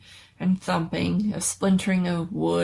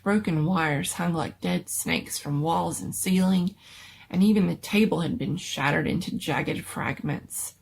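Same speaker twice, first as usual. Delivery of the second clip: ordinary delivery, nothing marked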